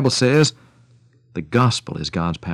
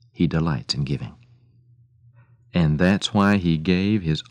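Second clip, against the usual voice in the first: gentle preaching; 4 semitones lower than usual. Real, not acted. Radio preacher telling listeners of his heavenly Father, gentle and sincere.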